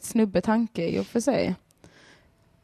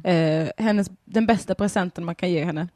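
ordinary talk

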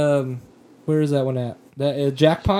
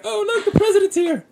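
very high-pitched mocking imitation; mock excited onlookers; 14 semitones higher than usual. Host imitating everybody else reacting to the president, mock excitement. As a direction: squeal it like an overexcited crowd, mockingly. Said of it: "They're like".

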